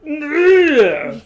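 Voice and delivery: loud laugh. Real, not acted. Co-host laughing at his friend's hospital story, a loud burst. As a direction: burst of real high laughter.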